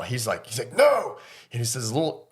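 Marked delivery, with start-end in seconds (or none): 0.8-1.2 put-on young boy's sudden high yell; Gohan's defiant cry. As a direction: burst out with a shrill no. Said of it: "you never hear him, like, yell".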